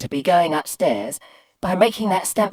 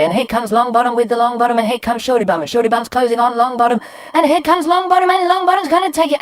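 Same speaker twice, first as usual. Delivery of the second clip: fast, high, loud, climbing to a frenzy; race-caller building excitement to a high peak; racing commentary; 7 semitones higher, 6 dB louder, faster than usual. Retold or imitated. Boy commentating drips like horse racing, frantic commentary. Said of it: "start doing a running commentary".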